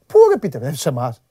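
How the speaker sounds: indignant outburst. Real, not acted. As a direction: burst out at the commenters. Angry rant at commenters.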